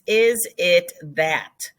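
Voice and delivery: slow, deliberate. Shown as a demonstration. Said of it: "esca actually means".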